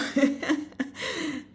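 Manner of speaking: high bright burst of laughter; delighted laugh. Real, not acted. Teacher recalling her student finally in tune, delighted laugh. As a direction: laugh with real joy at the memory.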